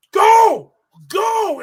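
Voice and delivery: high, loud urging yell; hammed-up cornerman yell. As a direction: scream at him to run. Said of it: "M V P's like".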